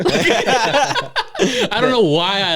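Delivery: giggling through the words, slow; can barely talk for laughing. Real, not acted. Laughing at own lie.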